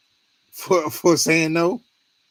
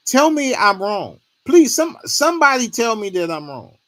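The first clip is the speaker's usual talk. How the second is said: higher, louder pleading, almost begging; pleading outburst; 8 semitones higher, 6 dB louder than usual. Real, not acted. Vlogger begging his viewers to tell him he's wrong, pleading loudly. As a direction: throw it out to the viewers, desperate for an answer.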